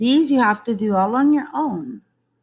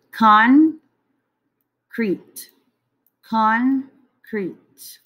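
concrete split as con, crete, with a beat on each; syllable by syllable; 4 dB louder, much slower than usual. Shown as a demonstration. Teacher tapping syllables with a wand for students. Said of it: "two syllables in the word concrete".

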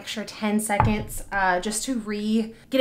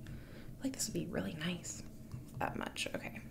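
whispering; 3 semitones higher, 12 dB quieter than usual. Real, not acted. Quiet aside while waxing.

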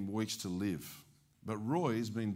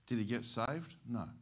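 normal talking voice

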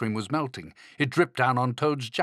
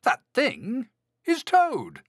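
high, slow astonished reveal; storybook Frog agog; amazed realisation; 13 semitones higher, 4 dB louder, slower than usual. A narrator's reading. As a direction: stretch it out in disbelief. Frog realises who thing is.

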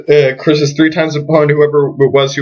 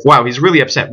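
ordinary delivery, nothing marked

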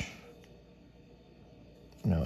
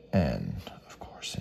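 unvoiced whisper under the breath; 13 semitones lower, 6 dB quieter, slower than usual. Real, not acted. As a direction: whisper it, resigned. Mutters on a missing letter.

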